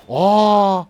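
sudden high, loud "Aa!"; a jolt of real surprise; startled gasp. Real, not acted. Gamer yelps at an unexpected hit, startled.

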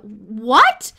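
sudden loud shout of disbelief. A narrator's reading. Boy shouting at the doctor across the dinner table. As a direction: blurt it out loud, stunned. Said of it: "I shouted".

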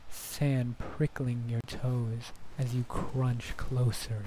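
soft breathy ASMR whisper. Acted. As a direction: hush it like an ASMR video. Guided beach imagery, ASMR.

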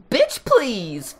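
sassy high bitch please; sassy quote. Retold or imitated. Reading the listicle quote, sassy. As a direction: toss off the bitch please with attitude. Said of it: "That's in quotes".

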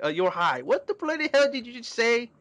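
rising incredulous yelp; shocked outburst. Acted. [name] reacting to [name]'s news, high shocked. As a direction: voice jumps high in disbelief.

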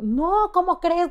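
drawn out disbelief; brand shocked she rides the bus; mock surprise. Brand reacting.

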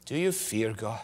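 quiet searching question. Real, not acted. Direct question to the congregation.

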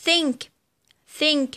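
"think" with breathed th at the start; voiceless TH. Shown as a demonstration. Teacher gives vowel examples, "think" with voiceless th. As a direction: model "think" twice with unvoiced th. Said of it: "وتنطق ث".

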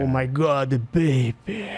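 lightly voiced dubbed film star, low; low catchphrase in a put-on star voice; Keno impression. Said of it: "eu ficava imitando Keno".

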